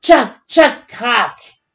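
loud, high axe blows into a crack; chopping then cracking. A narrator's reading. Narrator voicing the tree being felled, percussive. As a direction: chop hard, then let it split. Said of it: "Dans un gémissement terrible".

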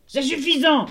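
high, loud angry shout; storybook god's furious shout; yelling. A narrator's reading. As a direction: cut her off with a yell. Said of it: "hurla Hadès".

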